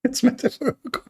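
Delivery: high squeaky mock voice; high mocking parody of an apologist. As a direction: pitch way up to ridicule the excuse. Mocking "metaphorical" excuse.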